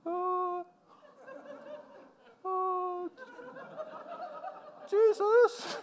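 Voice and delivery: high, soft, lost whimper of a disciple; a forlorn follower staring at the sky; bewildered disciple. Retold or imitated. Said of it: "They're all standing there going".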